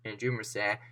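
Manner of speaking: ordinary delivery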